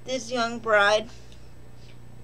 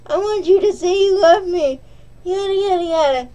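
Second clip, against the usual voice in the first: higher, louder lovesick demand; mock girlfriend voice; 8 semitones higher, 9 dB louder than usual. Reviewer imitating Josette begging him to say love, high and loud. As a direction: play the needy fiancée, then rattle off the yadas.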